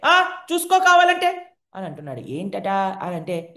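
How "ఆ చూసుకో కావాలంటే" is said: high, loud and slow, as if mouth full; a boy hiding mud, loud so mother believes; loud bluff. Acted. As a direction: bluff it out loudly so she believes. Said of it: "ఇలా గట్టిగా మాట్లాడితేనే".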